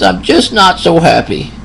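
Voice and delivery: high, lightly put-on old man's voice; elderly patient. Old patient quoted by nurse.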